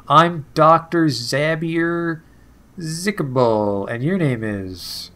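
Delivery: slow, drawn-out doctor with an odd timbre. Acted. Clinic doctor greeting a new patient, slow and drawn out. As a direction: roll out the name slowly, like a showman.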